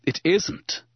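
high, wounded insistence; insistent. A narrator's reading. Obi-Wan denies Anakin's accusation, insistent. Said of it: "Obi-Wan insisted. He looked wounded".

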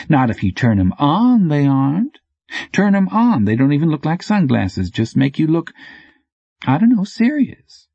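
high-pitched, breezy character voice; teasing bike courier. A narrator's reading. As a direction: explain it lightly, pitched well up. Sammy explains, then teases Chevette.

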